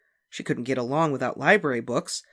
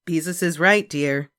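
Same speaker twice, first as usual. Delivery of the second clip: mother; 4 semitones higher, 7 dB louder than usual. A narrator's reading. Mother voiced in story.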